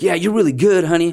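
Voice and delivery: mom's praise. Retold or imitated. Mom praising her son, doting and high. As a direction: gush like a proud mom.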